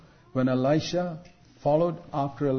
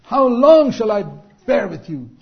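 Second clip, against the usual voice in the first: loud, high, drawn-out exasperation; preacher acting out a weary rebuke; exasperated rebuke; 7 semitones higher, 10 dB louder than usual. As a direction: cry it out slowly at disappointing disciples. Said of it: "Jesus said that even to his disciples".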